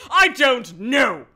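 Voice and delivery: loud, slow, drawn-out cry; hammy outburst. Acted. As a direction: wail it melodramatically. Hammy answer to Julia.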